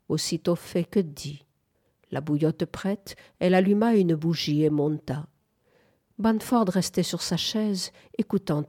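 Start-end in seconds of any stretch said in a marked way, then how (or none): none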